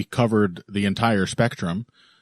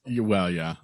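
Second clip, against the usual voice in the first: normal talking voice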